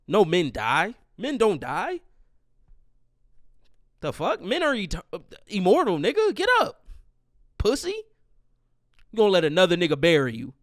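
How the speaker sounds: loud, squealing-high mock disbelief; mocking tirade. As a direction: heckle a dead man as if he could get up. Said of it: "walk into the casket like".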